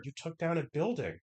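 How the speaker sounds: dry flat rebuke; deadpan repeat. Retold or imitated. Acted quote of Miu.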